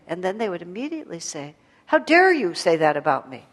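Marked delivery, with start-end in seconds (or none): 1.9-3.4 loud, offended retold protest; mock-indignant outburst; indignant retort. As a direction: act out taking offence, forcefully.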